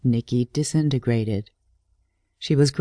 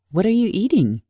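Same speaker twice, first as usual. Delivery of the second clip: surprised; 7 semitones higher, faster than usual. Acted. Girl startled by boyfriend choking.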